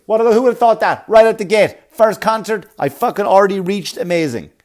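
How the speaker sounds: loud, mocking disbelief; loud sarcastic rant. Real, not acted. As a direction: blast it with sarcasm, high and loud. Sarcastic rant about praised kids.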